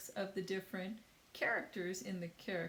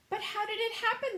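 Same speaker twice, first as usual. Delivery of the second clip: high, loud panicked creatures; crying out in alarm; 14 semitones higher, 6 dB louder, faster than usual. A narrator's reading. Teacher voicing the insects crying out in fear. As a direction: cry out, lost at sea. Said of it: "they cried".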